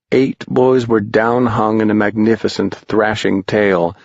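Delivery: ordinary delivery, nothing marked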